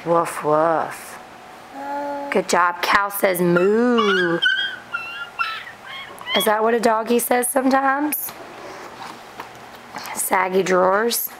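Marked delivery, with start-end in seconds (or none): 0.0-3.4 put-on reading to a toddler. Reading picture book to toddler. 3.4-4.4 drawn-out moo. Pretend. 6.4-8.3 put-on reading to a toddler. Reading picture book to toddler.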